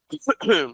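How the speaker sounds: short throat-clearing cough; dry cough. Real, not acted. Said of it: "desculpa a tosse". (tosse seca)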